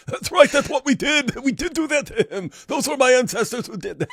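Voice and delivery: high, put-on braggart voice; mock boasting. Host mimics guys bragging in messages, mock boasting. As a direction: crow it proudly in a squeaky parody.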